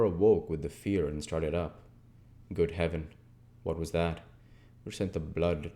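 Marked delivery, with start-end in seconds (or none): none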